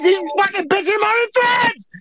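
high-pitched, strained shouting; exasperated mock rant. Mock rant about a complainer.

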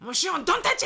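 loud, sharp retold scold. Mother scolds her son in the hallway, loud. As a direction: snap at the child loudly. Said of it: "結構大きい声で".

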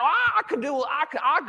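high, laughing mock brag, all about me; mock self-focused boast. Preacher mocking the self-centred believer, laughing mock boast. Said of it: "we made a lot of the things about".

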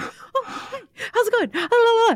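high breathy hello trailing into la la la; airy bubbly greeting. Retold or imitated. Mocking her own chattiness with a high airy la la. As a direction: float up high, carefree and ditzy. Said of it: "'Cause I'm just like".

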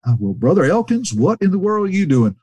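lightly voiced old preacher welcoming a friend; a hint of high-pitched delight; Brother Cates. Acting out Cates greeting his visitor.

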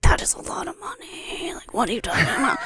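whisper, then a laughing half-shout; mock whispered shock; whispered disbelief. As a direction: hiss it in disbelief, then crack up. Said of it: "I'm like".

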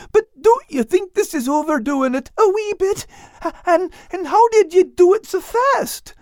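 very high, spluttering character voice; flustered little fellow, squeaking at a huge surprise; clumsy, astonished Al. Acted. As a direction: stammer high in shocked disbelief. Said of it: "Al clumsily said, spitting cocoa beans everywhere".

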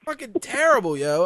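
normal talking voice

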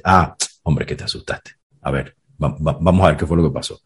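soft, hushed reassurance from a caring dad; tender father soothing his scared son; gentle father. Retold or imitated. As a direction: drop to a near whisper to comfort the boy.

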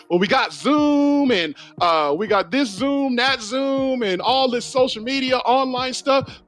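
high, slow whiny imitation of a skeptic; mocking objector. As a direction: mimic the excuse-makers, drawn out. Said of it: "some people gonna say".

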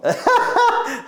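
high, bursting laugh at own slip; burst of laughter. Real, not acted. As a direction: break into laughter.

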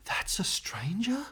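hushed wide-eyed whisper; awed child's whisper. Retold or imitated. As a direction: whisper it like a kid seeing something scary. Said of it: "He went".

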